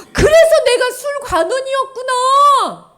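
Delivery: high, loud, slow eureka; excited realization. Acted. Nehemiah realizes his purpose with a loud, high cry. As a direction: cry it out as it dawns.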